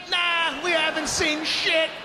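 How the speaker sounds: mock innocent shout. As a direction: yell it like you know nothing. Father denying, mocking neighbors.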